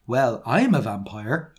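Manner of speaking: ordinary talk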